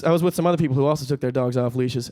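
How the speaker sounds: ordinary talk, nothing marked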